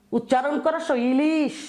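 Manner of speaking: normal talking voice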